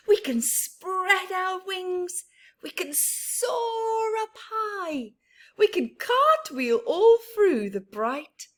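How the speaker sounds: cheerful flip-flap bird. Acted. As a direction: invite her up into the sky.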